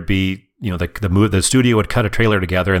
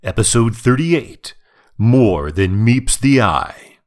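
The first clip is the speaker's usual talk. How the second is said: movie trailer voice; 2 semitones higher, slower than usual. Retold or imitated. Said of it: "The guy who says".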